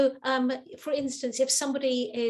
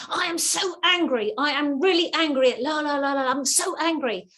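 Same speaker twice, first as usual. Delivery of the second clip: mock furious caller, louder and higher; angry caller; 4 semitones higher, 5 dB louder than usual. Caller ranting at a call-centre worker, mock anger. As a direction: act out an angry customer ranting. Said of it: "This person was saying".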